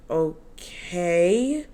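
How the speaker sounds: drawn-out okay, rising at the end; retold dubious agreement. Irritated speaker answering his offer to go eat, dubious. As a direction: agree warily, stretching it into a question.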